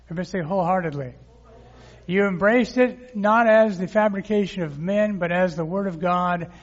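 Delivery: energetic pulpit reading; preaching. Real, not acted. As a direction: proclaim the verses to the congregation. Preaching scripture to congregation.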